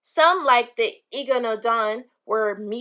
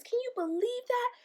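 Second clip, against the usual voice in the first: amazed question; 12 semitones higher, 8 dB quieter than usual. Real, not acted. Teacher asking the class, amazed and high. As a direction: sound truly amazed, pitched way up. Teacher marvels at dinosaur size.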